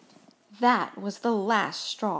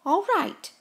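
higher, sighing acceptance; relieved; 8 semitones higher than usual. A narrator's reading. Gus accepts Mouse's promise, relieved. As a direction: let the tension go. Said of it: "said Gus with a sigh of relief".

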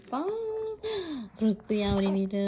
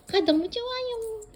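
much higher, delighted squeal; excited puppy voice; 13 semitones higher, 4 dB louder than usual. Pretend. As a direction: squeal with joy like the dog. Voicing the happy dog. (신난 강아지 목소리)

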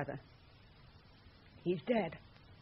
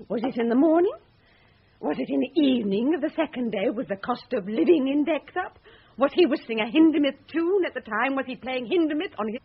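louder, scornful rhetorical questions; sarcastic mockery; 3 semitones higher, 7 dB louder than usual. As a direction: needle him with each question, building the sarcasm. Mocking his research questions.

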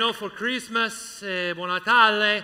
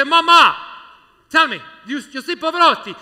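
loud, higher eager question down a payphone line; excited call to Mama; 6 semitones higher, 12 dB louder than usual. Retold or imitated. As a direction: shout over a bad phone line to Mama. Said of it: "I say".

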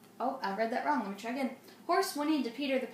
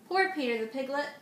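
ordinary delivery

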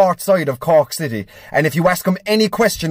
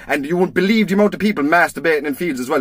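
ordinary talk, nothing marked

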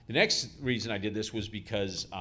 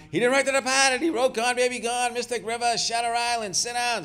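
much higher and louder, barking orders; shouting him down; 7 dB louder than usual. Retold or imitated. Bartender shouting the drunk back into his seat, loud and high. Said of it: "Bartender starts shouting him down".

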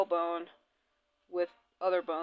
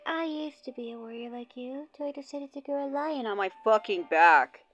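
mock character voice; 4 semitones higher than usual. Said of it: "She's like".

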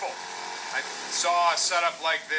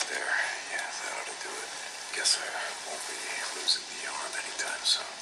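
pained whisper; 10 semitones lower, 5 dB quieter than usual. Acted. Patching a wounded arm.

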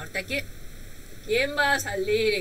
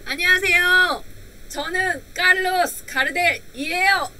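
high, loud bright self-introduction; cheerful demo greeting in Korean; 8 semitones higher, 5 dB louder, much faster than usual. Shown as a demonstration. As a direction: greet the class as the singer would. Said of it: "¿cómo va a hablar?".

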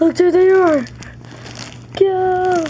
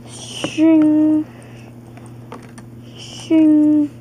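ordinary delivery

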